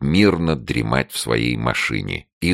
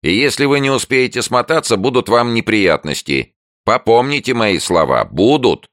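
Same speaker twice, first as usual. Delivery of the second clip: high, raised gloating warning; threatening neighbour; 11 semitones higher, 5 dB louder than usual. A narrator's reading. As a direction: warn them with relish through the door. Neighbour keeps threatening through door. (ехидная угроза соседки)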